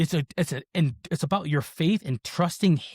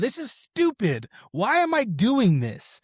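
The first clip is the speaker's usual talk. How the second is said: slow, high-pitched mock despair; exasperated prayer; 7 semitones higher, slower than usual. Runner praying in his head mid-race, exasperated. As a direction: drag out each word in exasperation.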